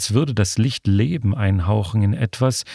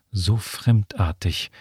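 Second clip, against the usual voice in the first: ordinary delivery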